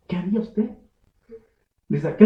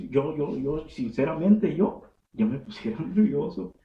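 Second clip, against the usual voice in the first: ordinary talk, nothing marked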